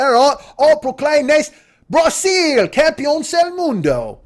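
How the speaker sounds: slow, high mock English accent. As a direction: proclaim it grandly as an English rock star. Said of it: "should have talked like this, like [name]".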